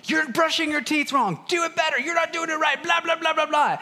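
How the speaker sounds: high, shrill bossy big brother yelling; high mock yell of a bossy child; bossy kid yelling. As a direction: mimic a kid scolding his brother, high-pitched. Just start yelling at the younger one.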